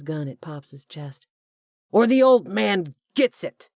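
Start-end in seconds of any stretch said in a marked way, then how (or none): 1.9-3.7 high, loud screaming threat; screamed threat. A narrator's reading. Hugh threatening to shoot Pops, screaming.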